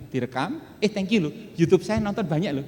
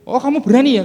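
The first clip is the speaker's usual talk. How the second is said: challenging boss; 9 semitones higher, 11 dB louder than usual. Retold or imitated. Council member challenging the secretary.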